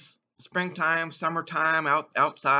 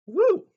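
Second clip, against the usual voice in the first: high, louder whoop of a cheer; loud woo; 5 dB louder than usual. Real, not acted. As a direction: whoop it up for the toast. Cheer after a toast.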